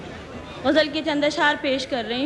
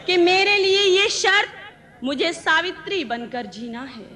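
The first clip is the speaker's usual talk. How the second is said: measured verse, repeats lifted higher; ghazal recitation; 4 semitones higher than usual. Acted. Poet presenting her ghazal to the gathering, measured recitation. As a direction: recite each line deliberately, pausing between phrases.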